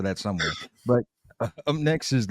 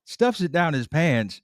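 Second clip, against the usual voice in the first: ordinary delivery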